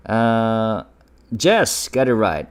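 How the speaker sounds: ordinary delivery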